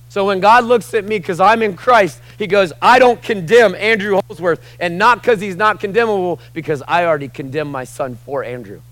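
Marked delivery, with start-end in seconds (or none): 0.1-7.0 high, loud, fervent preaching; preaching at full force. Acted. Preacher shouting no condemnation to the church. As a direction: lift the room with every word.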